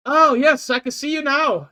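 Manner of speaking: put-on very high, loud muddled Scottish-Italian accent; hammed-up Scottish-Italian caller; attempted Scottish-Italian accent. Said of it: "I'm not gonna try that accent".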